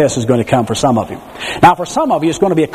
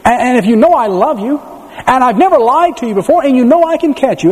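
higher, building coaxing father; pleading father; 7 semitones higher than usual. Retold or imitated. Father coaxing his daughter to jump into the dark. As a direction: coax her, building up. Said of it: "And then he said this".

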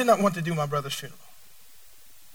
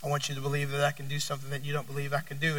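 gentle voice of God; 8 semitones lower, 15 dB quieter, faster than usual. Retold or imitated. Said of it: "So God said".

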